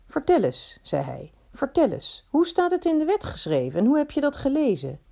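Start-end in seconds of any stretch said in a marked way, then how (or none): 0.1-0.8 higher-pitched, lightly amused invitation; amused prompting. A narrator's reading. Teacher prompts the law scholar to answer, lightly amused. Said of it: "lachte naar me". 1.5-5.0 high-pitched questioning with a touch of amusement; amused prompting. A narrator's reading. Teacher quizzes the law scholar on scripture, lightly amused. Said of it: "lachte naar me".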